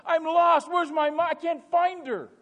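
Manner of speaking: loud, high cry for help; crying lost child. Acted. Lost child telling the whole world, crying out. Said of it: "And they're crying".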